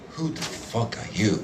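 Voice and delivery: very low, soft astronaut voice; low mock mumble; mumbled imitation.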